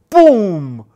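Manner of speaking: loud, high, drawn-out boom falling away; imitated gunshot boom. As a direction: boom it out like a flintlock going off. Imitates the flintlock firing.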